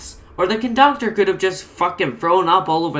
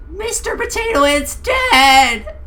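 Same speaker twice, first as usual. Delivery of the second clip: high, loud, slow wail; horrified scream; 10 semitones higher, 4 dB louder, slower than usual. Acted. Barbie screaming that Potato Head is dead, high and loud. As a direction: cry it out in horror. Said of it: "Barbie screamed".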